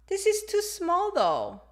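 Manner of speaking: high, slow dismayed exclamation; surprised complaint. Acted. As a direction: act out dismay, drawing it out. Acting out complaint about small clothes.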